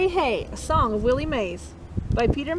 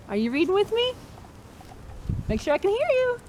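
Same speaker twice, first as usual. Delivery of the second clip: higher, coaxing call to read along; coaxing the class; 7 semitones higher, faster than usual. Real, not acted. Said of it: "urging class to read along".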